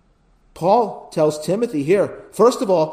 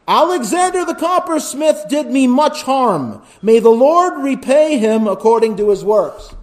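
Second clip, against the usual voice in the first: higher, louder verse read; emphatic scripture reading; 7 semitones higher, 4 dB louder than usual. Acted. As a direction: proclaim it from the pulpit.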